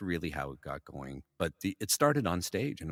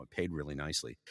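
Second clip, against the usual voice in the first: usual speaking voice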